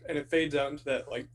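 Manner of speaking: normal talking voice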